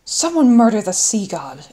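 loud, exasperated outburst; exasperated exclamation. A narrator's reading. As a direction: throw it out loudly in exasperation. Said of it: "exclaimed Toby, exasperated".

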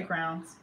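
"crowns" for crayons, one syllable. Shown as a demonstration. Telling a friend her pronunciation, crayons said as "crowns". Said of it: "I say crowns".

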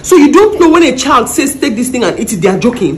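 loud furious scolding; angry scolding. Acted. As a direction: tear into her, shouting. Ma scolds house girl.

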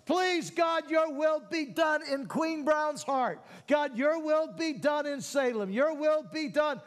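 high, loud prayer building to a near shout; rally the whole church to pray; rousing preaching. Acted. Pastor leading the church in prayer for the nation, rousing.

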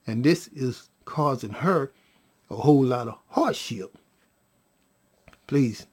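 soothing father. Retold or imitated. Daddy asks the baby to relax, soothing. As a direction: lull gently in a low, steady voice.

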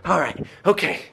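usual speaking voice

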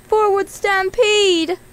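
high, slow, loud battle cry; yelled command. A narrator's reading. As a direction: yell the charge, drawing it out. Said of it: "she yelled".

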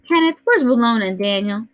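lightly voiced fussing grandmother; an old lady scolding the noise; Grandma Sands. A narrator's reading. Grandmother fusses at children raising noise early, lightly voiced. Grandma asks about parents.

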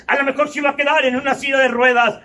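ordinary talk

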